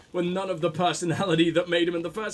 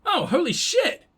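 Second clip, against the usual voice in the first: surprised; 10 semitones higher, 4 dB louder than usual. Real, not acted. Finally finished a mosaic.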